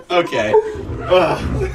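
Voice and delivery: normal talking voice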